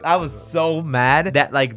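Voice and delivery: ordinary delivery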